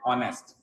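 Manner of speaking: ऑनेस्ट said with no h sound; ऑनेस्ट without the h; silent h. Shown as a demonstration. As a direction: say ऑनेस्ट starting on the vowel, h dropped. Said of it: "इसको कैसे पढ़ते हैं?".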